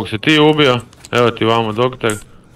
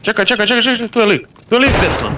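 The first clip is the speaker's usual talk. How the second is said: high, rushed urgent warning; urgent high callout; 13 semitones higher than usual. Real, not acted. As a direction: call it out fast in alarm. Enemy spotted, urgent callout.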